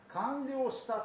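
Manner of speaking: high ringing cry of completion; cried out. Retold or imitated. Jesus crying out on the cross, a ringing high call. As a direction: lift it up and call it out. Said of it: "と叫んだ".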